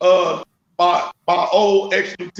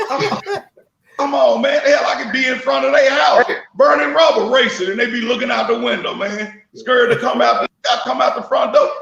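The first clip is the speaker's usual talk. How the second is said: laughing taunt; 4 semitones higher than usual. Real, not acted. Laughing taunt at scared racers.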